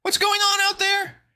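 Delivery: put-on high, loud, slow boyish shout; panicked shout. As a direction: yell it from below. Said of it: "shouted from the basement".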